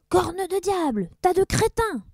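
high, loud pirate cursing; furious yell. Acted. Blackbeard yells at his useless crew. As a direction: curse the crew at full voice. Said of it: "hurla Barbe Noire".

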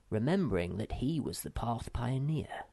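plain reading voice

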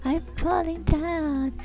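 very high, soft, drawn-out mock wail; mock Lara Croft. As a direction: feeble falsetto falling cry, making fun of her. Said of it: "Now, Lara Croft would have gone".

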